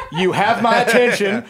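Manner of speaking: amused disbelief bursting out in a laugh. Real, not acted. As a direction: crack up as you say it. Laughing at the doctors' grim news.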